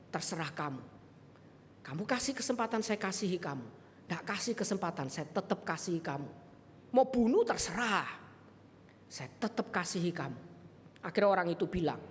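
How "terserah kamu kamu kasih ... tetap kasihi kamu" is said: softer, lower, gently sincere; a quiet vow of love; tender pledge. Retold or imitated. Preacher softly promising the chief he will still love him.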